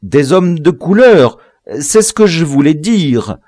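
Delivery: high, loud, eager appeasing voice; anxiously deferential; humble. A narrator's reading. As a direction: hurry to agree with the master. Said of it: "répondit humblement le négrophile".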